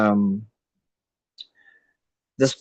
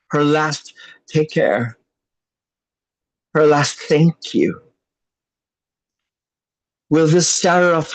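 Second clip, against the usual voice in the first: rhythmic, emphatic poetry recitation; spoken word poem; 4 semitones higher than usual. Acted. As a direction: perform it like a slam poem. Recites second poem about grandmother.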